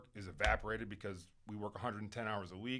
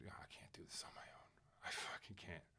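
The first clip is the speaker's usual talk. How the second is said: whispered, much softer, pleading upward; desperate whisper; 3 semitones lower, 12 dB quieter than usual. Retold or imitated. Retelling his plea to God, whispered. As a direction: breathe it out like a broken prayer.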